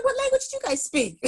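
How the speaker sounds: disbelieving high-pitched question; incredulous. Retold or imitated. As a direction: ask it in total disbelief. Friend says ESL class, incredulous.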